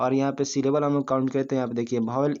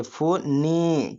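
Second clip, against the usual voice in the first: फो ने split and drawn out slowly; syllable-by-syllable demo; 2 semitones higher, much slower than usual. Shown as a demonstration. Teacher counting syllables for students, फो ने slowly split. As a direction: separate फो and ने, holding each. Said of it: "क्या बोले?".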